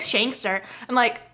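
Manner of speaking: ordinary talk, nothing marked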